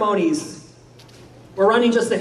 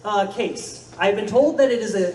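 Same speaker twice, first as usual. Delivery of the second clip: normal talking voice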